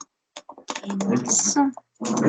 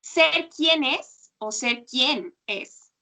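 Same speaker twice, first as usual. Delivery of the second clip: slow, second quién stressed and lifted; slow contrast demo; much slower than usual. Shown as a demonstration. Teacher models both versions slowly for the student. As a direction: spell out quien then quién slowly. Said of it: "Sí, exacto".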